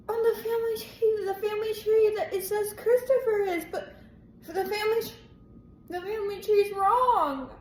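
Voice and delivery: can't believe what she just read; shocked disbelief. Real, not acted. Reader reacting to a twist in the family tree, shocked.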